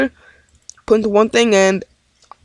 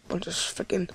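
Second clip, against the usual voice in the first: breathy whispered mutter under the breath; voiceless aside, barely audible; whispered grumble; 17 dB quieter than usual. Real, not acted. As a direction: hiss it to yourself, annoyed. Muttering to himself at game.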